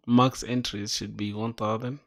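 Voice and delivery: ordinary talk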